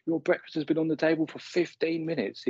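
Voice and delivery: high, mock-shouted nag at a lazy partner; imitating a shout. Girlfriend calling to Derek about breakfast, mock shout. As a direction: play the fed-up girlfriend calling him out of bed. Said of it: "shouts to him".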